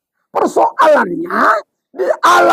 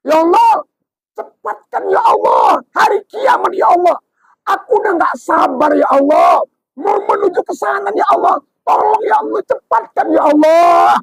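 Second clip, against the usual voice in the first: high, eager cries to hurry doomsday; begging God to hurry it, rising and calling out; 6 semitones higher than usual. Acted. Soul in the grave begging God for doomsday, eager cries.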